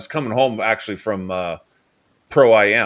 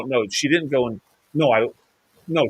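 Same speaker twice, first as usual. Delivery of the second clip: ordinary talk, nothing marked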